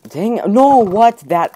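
loud, high outburst at getting hit; shouted dang no what; frustrated yell. Real, not acted. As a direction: yell it at the screen. Just got killed.

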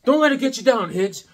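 pep talk to Higgs; mock pep talk. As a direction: cheer him up like a friend. Encouraging himself.

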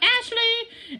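high, sing-song call of Ashley; mom calling a name. Retold or imitated. Teacher imitates mom calling her from another room, high call. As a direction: call the name across the house. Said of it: "they just call you".

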